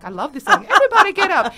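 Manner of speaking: loud, high, gleeful party shout; excited party fan. Retold or imitated. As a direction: hype the room like a fan on the dance floor. Mock party-goer loving the song.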